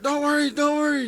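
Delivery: high, loud mimicked woman; mock reassuring woman. Storyteller mimicking a woman soothing him, loud high voice. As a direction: push the voice way up, imitating her.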